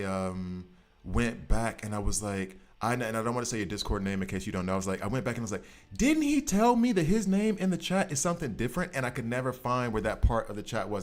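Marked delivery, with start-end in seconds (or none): 6.0-8.9 high questioning voice. Retold or imitated. Retelling own thought aloud.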